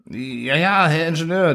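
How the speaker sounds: high, panicky stammer; terrified assistant answering his boss; panicked trembling. A narrator's reading. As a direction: squeak it out in fear. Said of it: "Die Stimme zitterte vor panischer Angst".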